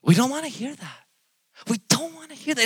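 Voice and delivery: high-pitched mimicked protest; mock reluctance. Mimics people refusing hard truths.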